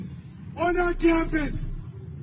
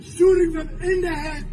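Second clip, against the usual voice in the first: ordinary delivery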